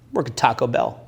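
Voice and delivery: low-pitched, unashamed deadpan; deadpan low-status answer. Shown as a demonstration. Man answers woman about his job, deadpan low voice. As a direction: drop it low and matter-of-fact.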